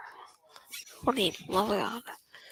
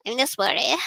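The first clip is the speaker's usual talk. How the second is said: ordinary talk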